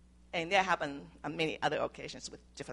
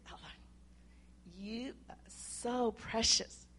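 tender to grandchild; 3 semitones higher, 5 dB quieter than usual. Real, not acted. Granddaughter on her lap.

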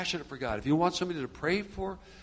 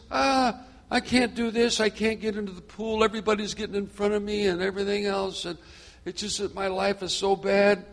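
higher-pitched, self-pitying mock moan; victim's complaint; 5 semitones higher than usual. Man at the pool excusing himself to Jesus, self-pitying complaint. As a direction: play the helpless man making excuses. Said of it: "he was victimized".

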